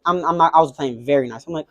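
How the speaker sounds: usual speaking voice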